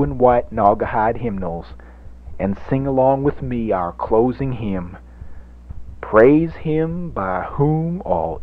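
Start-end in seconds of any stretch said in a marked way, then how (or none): 6.0-8.4 solemn announcement. Acted. Announcing the hymn title.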